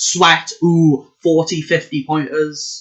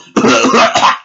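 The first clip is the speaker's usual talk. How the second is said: fake cough; 6 semitones higher, 7 dB louder than usual. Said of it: "I don't know why I was coughing there".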